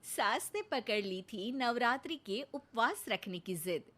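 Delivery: even storyteller voice introducing the scene; sketch narration. A narrator's reading. Narrator opening the family comedy sketch, storytelling. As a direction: set up the comic story plainly.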